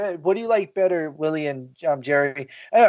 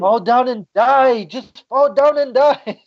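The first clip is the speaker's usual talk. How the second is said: mock dramatic; 7 semitones higher, slower than usual. Mocking dramatic line.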